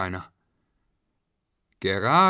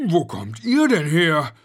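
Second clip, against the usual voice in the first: loud, higher surprised question; startled father; 7 semitones higher, 7 dB louder than usual. A narrator's reading. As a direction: exclaim it in astonishment. Father surprised by hidden children.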